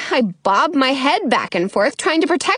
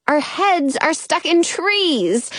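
plain reading voice

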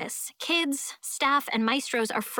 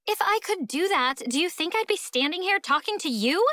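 higher-pitched sarcastic comeback; exasperated retort; 7 semitones higher than usual. A narrator's reading. Stuck guitarist answers impatient schoolmate, exasperated retort. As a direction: throw it back at her, pitched up.

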